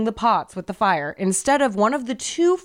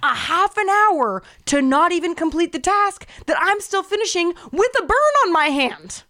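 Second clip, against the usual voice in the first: indignant retort; 9 semitones higher than usual. Retold or imitated. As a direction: re-enact the fight, pitch held high. Acting out the screaming fight.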